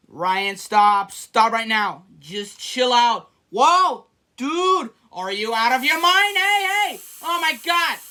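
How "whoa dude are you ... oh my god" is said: high, startled yells; shocked shouting. Real, not acted. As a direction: cry out in disbelief. Sprayed again, shocked shouting.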